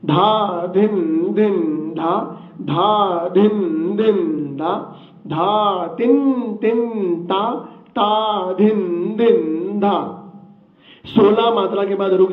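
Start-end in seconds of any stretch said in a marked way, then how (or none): 0.1-7.5 very slow recitation, syllables drawn out; even slower. Shown as a demonstration. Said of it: "मैं और भी धीरे बोलता हूं". 7.9-10.2 ता धिन धिन धा very slow, each bol drawn out; even slower. Shown as a demonstration. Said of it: "मैं और भी धीरे बोलता हूं".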